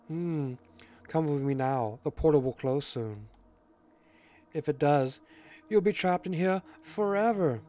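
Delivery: haughty cat voice, stretched out on the threat; presumptuous cat. Acted. Cat warns Red about the closing portal, presumptuous. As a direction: play the presumptuous cat, drawing out the warning. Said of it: "cat's almost spot on every time with the presumptuous".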